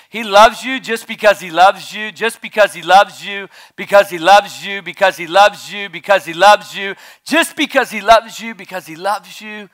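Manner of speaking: rising declaration. Acted. Preacher declaring love over the student, loud and high.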